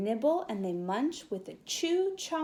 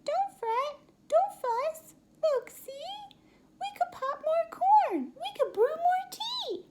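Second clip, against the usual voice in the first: much higher, tiny mouse voice; high little mouse reassuring the bear; squeaky mouse; 4 dB louder than usual. Acted. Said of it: "Mouse squeaks".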